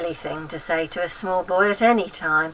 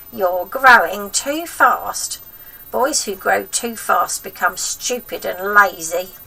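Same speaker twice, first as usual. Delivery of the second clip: bossy wicked granny, pitched up and loud; grandma voice; 5 dB louder, slower than usual. Acted. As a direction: nag the boy like a spiteful old grandma.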